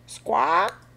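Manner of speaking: high parrot squawk; parrot's cry. A narrator's reading. Parrot on a boat hit by a wave, squawking. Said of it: "cries the parrot".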